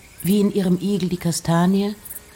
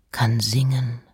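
whisper; 4 semitones lower than usual. Acted. Poem reading, fame as bee.

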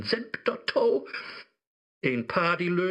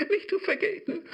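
laughing; faster than usual. Acted. Mephisto gloats over data.

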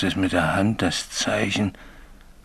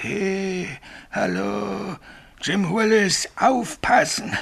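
imitated ghost call; 11 semitones higher, slower than usual. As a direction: echo the phantom's call as if haunted by it. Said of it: "Und ruft". (hoher Geisterruf, leise anschwellend)

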